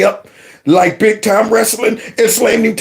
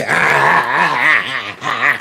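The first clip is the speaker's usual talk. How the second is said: low mocking cackle; mocking laugh; 6 semitones lower than usual. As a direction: laugh down at the teacher. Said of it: "And he had this laugh".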